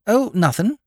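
light, high disclaimer; airy backing-off. A narrator's reading. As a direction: brush it off innocently. Backing off after farmer's outburst.

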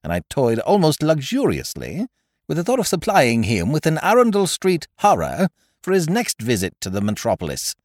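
usual speaking voice

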